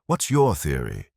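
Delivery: ordinary delivery, nothing marked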